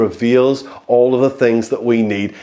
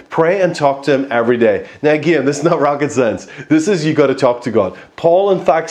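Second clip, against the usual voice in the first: normal talking voice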